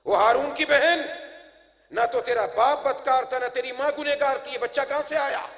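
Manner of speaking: declaimed reproach in a preacher's voice; accusing crowd. Retold or imitated. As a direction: challenge her as the objecting people. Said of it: "لوگوں نے اعتراض شروع کر دیے".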